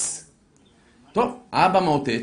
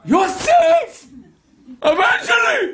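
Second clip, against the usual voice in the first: high, loud, stretched-out wail; panicked father crying out; 8 dB louder, much slower than usual. Acted. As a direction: call desperately for your lost son. Father realizes son was left behind. (זעקת אב בבהלה, מוגזמת)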